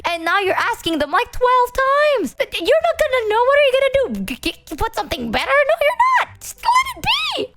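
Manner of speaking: high, pitch climbing into a squeaky exasperated protest; exasperated rant. Retold or imitated. Patient in hospital scolding her mother, exasperated and squeaky. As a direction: let the voice crack upward in disbelief.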